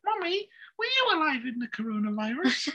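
put-on high little child asking; kid voice. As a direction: play a curious small kid. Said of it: "Our, our kids are gonna be learning".

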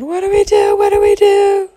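loud, high-pitched panicked cry; frantic high wail; acted panic. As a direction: squeal it out in mock terror. Friends panic after seeing ghost.

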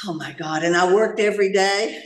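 ordinary delivery

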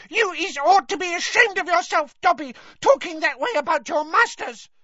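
loud, very high scolding squeal; furious Winky elf. Acted. Winky scolds Dobby about his masters, furious squeal.

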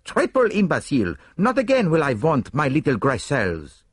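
put-on high, accented self-scolding; Poirot's cry. A narrator's reading. Poirot berates himself on the platform, high exclaiming cry. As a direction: exclaim in vexation at yourself. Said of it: "he cried".

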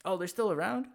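taken aback, pitch jumping up; surprised question. Real, not acted. Hearing the preachers are still on campus, surprised. Reacting to unexpected news.

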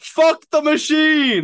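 very high, loud, drawn-out mock scream; furious impression. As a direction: scream it out slowly in mock fury. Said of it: "That was a great impression".

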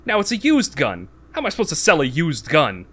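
high, loud imitation of indignation; mock exasperated outburst. Nick Cage's outraged protest retold.

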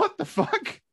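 very high, laughing what-the-fuck; laughing disbelief. Retold or imitated. Scoffing at an outdated insult, a high laughing squeal. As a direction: squeal it in amused outrage. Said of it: "I'm like".

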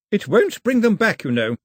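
put-on high, loud cutting old lady; cruel grandmother.

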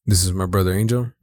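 ordinary talk, nothing marked

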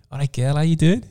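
put-on a light Scouse lad hitting on a girl; cheeky Scouse chat-up. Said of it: "and he went".

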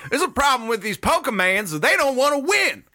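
high, hammed-up coach rant; country Little League coach. Coach griping about lazy Pokemon, high country rant. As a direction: complain like a worked-up country coach. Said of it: "like a Little League coach".

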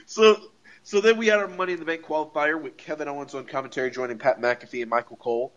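ordinary talk, nothing marked

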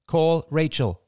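high, deliberate command to a phone. A narrator's reading. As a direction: enunciate it for voice recognition.

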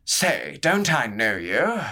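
a tipsy noble leaning in curiously; drunk courtier. Acted. Drunken courtier prodding Hatham.

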